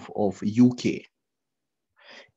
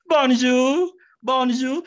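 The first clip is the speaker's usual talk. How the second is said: "bonjour" much higher and louder, slow and rising; "bonjour" pitched up high with a rising end; 8 semitones higher, 5 dB louder, slower than usual. Shown as a demonstration. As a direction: lift "bonjour" up high and stretch it slowly. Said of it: "you own even the intonation of bonjour".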